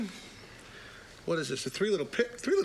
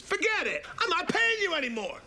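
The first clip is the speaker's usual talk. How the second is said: high, defiant little pig voice; squeaky pig talking back; high pig voice refusing the wolf; 11 semitones higher than usual. Acted.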